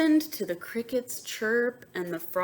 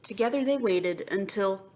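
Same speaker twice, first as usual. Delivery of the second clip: ordinary delivery, nothing marked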